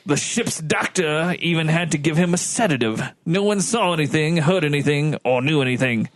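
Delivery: formal officer giving testimony; a hint of stiff naval officer; lightly voiced ship's lieutenant. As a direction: read it out as a lightly acted witness statement.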